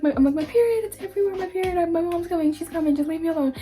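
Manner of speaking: high, flustered voice telling the principal; flustered explanation. Retold or imitated. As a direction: pitch up, tumbling over the words in embarrassment. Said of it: "I'm just like".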